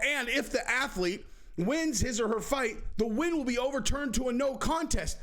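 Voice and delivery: high mock rule reading; mock official. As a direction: read the rule like a smug official. Mocking the commission again.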